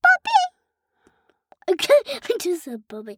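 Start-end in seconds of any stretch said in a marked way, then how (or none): 0.0-1.7 high squeaky blurt. Pretend. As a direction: squeak the name out.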